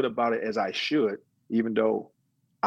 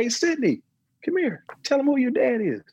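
very high mimicked mother's voice; imitated mom calling. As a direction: call the child over in a high put-on voice. Retold mom calling her daughter over.

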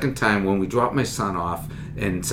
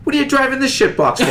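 high, loud mocking jab; Boston trooper mocking the comedian's car, high and loud; blunt Boston cop; 6 dB louder than usual. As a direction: rib him like a Boston cop.

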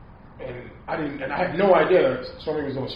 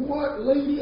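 much higher, comic disbelieving squeak; shocked squeak; 13 semitones higher, slower than usual. Retold or imitated. Man hearing a medium read his past, startled squeak. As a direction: jump up into a startled falsetto.

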